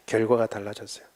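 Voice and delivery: quiet echo. Shown as a demonstration. Preacher echoes his point to the congregation, softly. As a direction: let it settle, softly.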